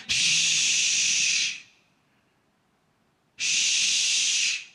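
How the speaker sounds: long whispered shh; shushing. Pretend. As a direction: hush a restless world. God hushing a restless world.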